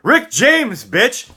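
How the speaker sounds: loud comic catchphrase shout. Retold or imitated. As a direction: bellow the punchline right in his face. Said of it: "the only possible thing that I would have to say to him is".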